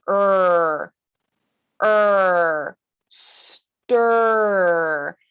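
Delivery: long, sustained "er", then "stir" with the same stretched vowel; R-colored vowel demo. Shown as a demonstration. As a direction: hold the "er" sound steady in "Er, er, stir". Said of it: "it sounds like this".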